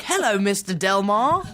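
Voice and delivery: put-on saying it in his own British accent; British accent, no American. Actor to director, in his native British accent. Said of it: "forgot to do an American accent".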